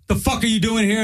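a touch of an aggressive cop; quoting a rude cop. Retold or imitated. Comedian retells the sheriff barking at him, mildly mocked.

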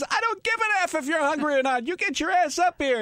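high, mock-furious mother yelling down the stairs; angry mom yelling. Mom at the top of the stairs yells down at her son, high mock fury. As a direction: play the mom catching a kid out of bed.

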